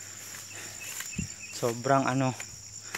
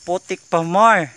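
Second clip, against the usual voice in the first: exasperated; 4 semitones higher, 8 dB louder than usual. Real, not acted. Mock complaining about muddy climb.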